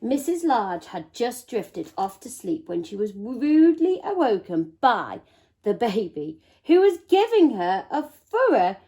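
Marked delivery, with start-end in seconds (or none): none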